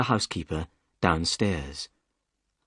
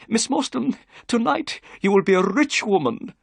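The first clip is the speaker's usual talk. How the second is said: higher, eager gentleman's voice; Thaddeus Sholto; 12 semitones higher, 4 dB louder than usual. A narrator's reading. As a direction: voice the excitable host. Sholto's voice in the story.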